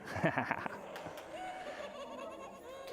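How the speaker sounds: high screeching witch cackle. Acted. Librarian makes the book's witch cackle for the children. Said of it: "a spooky witch with a cackle".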